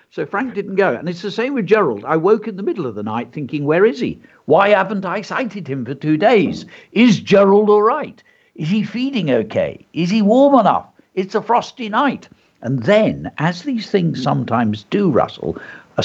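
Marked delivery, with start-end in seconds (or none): 3.6-12.3 mock worry. Worrying about mouse at night.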